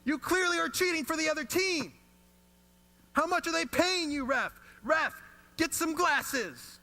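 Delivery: put-on high, loud, furious child yelling; boy at the game yelling at the referee, high and loud; kid yelling at ref. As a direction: scream at the ref like an angry ten-year-old. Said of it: "he starts yelling at the ref".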